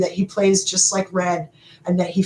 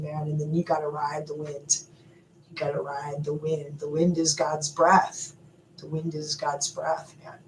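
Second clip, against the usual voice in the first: soft spiritual hush; 4 semitones lower, 9 dB quieter than usual. Real, not acted. Vlogger sharing spiritual wisdom, softly reverent. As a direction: murmur it like a sacred truth.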